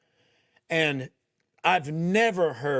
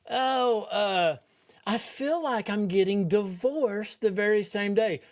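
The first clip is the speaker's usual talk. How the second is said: mocking; 6 semitones higher than usual. Mocking the speaker's retold quote.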